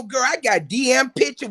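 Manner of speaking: normal talking voice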